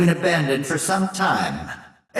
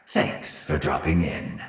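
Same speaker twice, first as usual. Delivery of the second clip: ordinary talk, nothing marked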